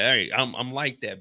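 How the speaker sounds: normal talking voice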